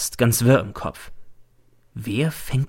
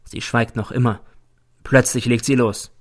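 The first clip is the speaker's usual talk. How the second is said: plain reading voice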